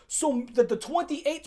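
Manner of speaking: ordinary delivery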